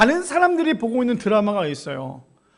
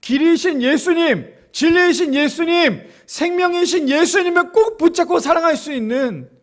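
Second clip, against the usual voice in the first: rising exhortation; 6 semitones higher, 4 dB louder than usual. Real, not acted. Closing exhortation builds up.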